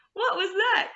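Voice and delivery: high, alarmed question; the little ant jumps at the noise; startled cry. A narrator's reading. Ant hearing something big nearby, high startled cry. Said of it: "cried the ant".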